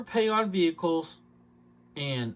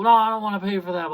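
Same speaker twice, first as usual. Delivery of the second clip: higher, louder, quick protest; mock customer refusal; 5 semitones higher, 8 dB louder, faster than usual. Salesman mimics customer refusing to pay, mock protest. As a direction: imitate a balking buyer, rushing the objection out.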